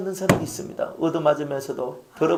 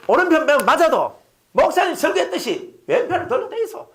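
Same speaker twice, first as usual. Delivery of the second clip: higher, laughing mimicry of the congregation; laughing mock quote; 9 semitones higher than usual. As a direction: tease them with their own wish. (웃으며 성도들 말 흉내)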